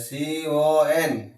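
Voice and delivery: very slow, letter by letter; broken-up spelling. Shown as a demonstration. Teacher showing pupil how to spell in parts, slowly. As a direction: draw out each letter of "সি ও এন" separately. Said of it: "ভেঙে ভেঙে উচ্চারণ করো".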